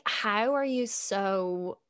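ordinary talk

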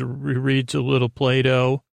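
usual speaking voice

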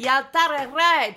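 slow, exaggerated South African accent. Repeating the street for Siri, mock South African accent. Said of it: "you have to speak in a South African accent".

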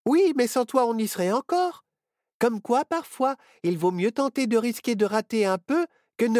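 put-on high bright girl's voice; young witch girl. A narrator's reading. Myrtille cheering on her friend, high girl's voice.

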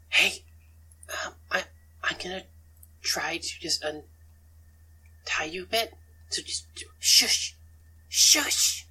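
halting stage whisper; acted secret rescue, all breath; furtive whisper. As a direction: whisper nervously, ending in forceful shushes. Secretly untying a prisoner.

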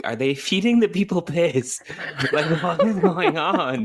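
high, laughing through the question; giggling what is going on; laughing disbelief. Real, not acted. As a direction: crack up while asking. Laughing at the piss trains.

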